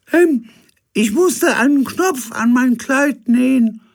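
very high, loud falsetto; Gisela's high voice. Acted. Figure at the door greets the captain, very high. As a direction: chirp it in a shrill lady voice. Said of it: "mit hoher Stimme".

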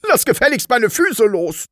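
high, indignant order; peremptory, high-pitched demand; commanding. A narrator's reading. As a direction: order it off you at once. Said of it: "Frau Rotkohl befahl".

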